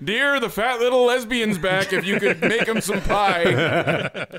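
laughing mock old man, high and stretched out; half-laughing old man calling to his wife; old man's voice. Old man telling his wife the kid's back, laughing. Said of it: "The old man's like".

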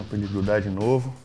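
ordinary talk

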